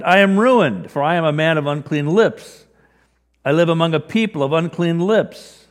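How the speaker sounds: crying out. Acted. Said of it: "I cried".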